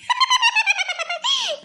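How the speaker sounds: shrill gleeful cackle. Acted. Wild laugh.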